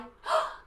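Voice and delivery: high delighted gasp; big happy gasp; excited gasp. Acted. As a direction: gasp with glee for the kids. Excited gasp after song.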